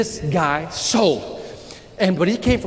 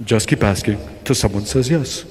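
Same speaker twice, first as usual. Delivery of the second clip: deep mentor voice; 10 semitones lower than usual. Retold or imitated. Mentor Jose giving Victor his secret in a deep voice. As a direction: deliver the secret like a smooth old salesman. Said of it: "talk like Ricardo Montalban with this, like, real deep voice".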